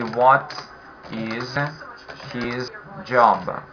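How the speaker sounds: each word set apart by pauses, falling on "job"; slow, word by word. Shown as a demonstration. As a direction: drill it slowly: "What ... is ... his job?". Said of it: "the whole sentence will be".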